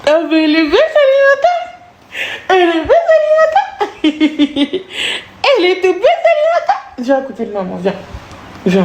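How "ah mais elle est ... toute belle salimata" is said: put-on very high little-girl voice, half laughing; squeaky high child voice. Mother voicing her little girl in a high squeaky voice.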